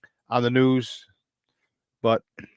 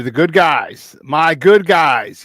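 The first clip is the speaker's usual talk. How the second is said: proud gloat; 7 dB louder, much slower than usual. Real, not acted. Celebrating win, drawn out.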